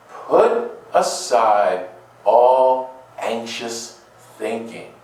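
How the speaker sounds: stretched out slowly, each word weighed; slow solemn exhortation. Shown as a demonstration. Preacher repeating Paul's words, slow and deliberate. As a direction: let every word land slowly. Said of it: "Think about it right now".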